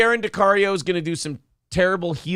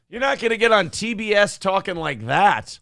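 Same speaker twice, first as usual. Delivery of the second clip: ordinary delivery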